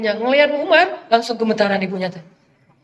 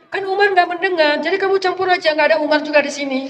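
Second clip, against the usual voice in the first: higher, urging voice of the scheming mother; 6 semitones higher than usual. Retold or imitated. Mother pushing her daughter to water the milk, higher and coaxing. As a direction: coax the daughter to cheat.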